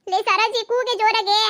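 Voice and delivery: loud shout to Saroji; calling out. Acted. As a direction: call to your friend over the noise. Urging friend to call louder.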